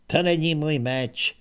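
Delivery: usual speaking voice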